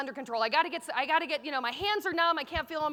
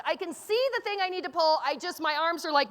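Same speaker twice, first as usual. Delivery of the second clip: normal talking voice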